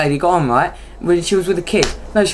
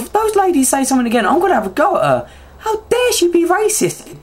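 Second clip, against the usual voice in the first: retold indignation; 7 semitones higher than usual. Retold indignant thought about bus ladies.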